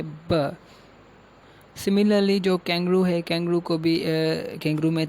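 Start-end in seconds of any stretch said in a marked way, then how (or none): 0.0-0.6 ब said alone as a bare consonant sound; ब voiced on its own, not as a letter name. Shown as a demonstration. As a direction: sound out ब by itself. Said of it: "वो consonant sound है".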